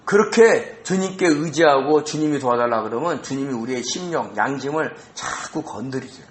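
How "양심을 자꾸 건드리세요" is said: ordinary talk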